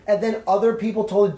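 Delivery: ordinary talk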